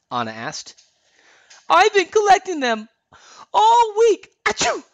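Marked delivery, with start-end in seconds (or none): 1.7-4.3 very high, loud, strained voice; congested cartoon squeal, swelling toward a sneeze; sniffly, about to sneeze. A narrator's reading. 4.4-4.8 high, loud sneeze; explosive high-pitched mock sneeze; big acted sneeze. A narrator's reading.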